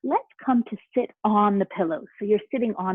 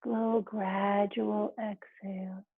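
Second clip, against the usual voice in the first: right in your ear; 3 dB quieter than usual. Acted. Leads a guided relaxation.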